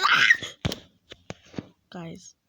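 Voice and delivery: usual speaking voice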